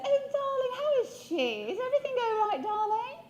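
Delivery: high, put-on upper-class English accent, darling and all; posh English accent. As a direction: imitate the posh recruiter in a plummy English accent. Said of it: "She spoke just like the Queen of England".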